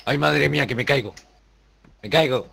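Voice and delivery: panicked cry, falling; screaming while falling. Real, not acted. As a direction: yell in panic as you fall.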